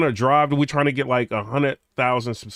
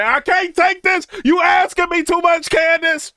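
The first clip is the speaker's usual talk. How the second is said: high, loud panicked mock wail; overwhelmed mock shout; 13 semitones higher, 5 dB louder than usual. Flustered boyfriend begging Candace to stop the quiz, high mock shout. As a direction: whine like a cornered boyfriend who cracks. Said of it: "You ain't gonna know what to say".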